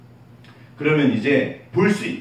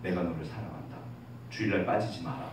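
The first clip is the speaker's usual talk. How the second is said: God's gentle voice; 8 semitones lower, 11 dB quieter than usual. Pretend. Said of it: "때로는 느낌이 와요".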